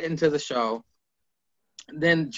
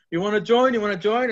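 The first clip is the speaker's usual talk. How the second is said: eager recruiting; 6 semitones higher, faster than usual. Retold or imitated. Retold eager recruiting pitch.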